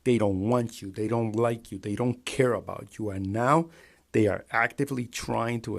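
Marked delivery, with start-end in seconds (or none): none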